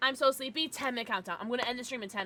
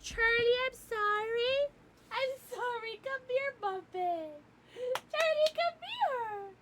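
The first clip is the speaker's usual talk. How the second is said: high sing-song pet voice, much higher; 13 semitones higher, slower than usual. Real, not acted. Streamer soothing her scared dog, high baby talk.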